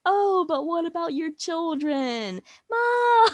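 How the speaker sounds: slow, then a high, whiny cry on Ma; hammed-up nosy neighbour fishing for dirt; mock Agnes. Mocking Agnes asking Wanda about her kids, high and whiny.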